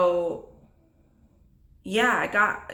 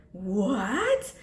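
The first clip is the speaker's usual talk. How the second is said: put-on surprised child. Daughter handed her mama's degree, amazed. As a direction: imitate a kid's amazed question.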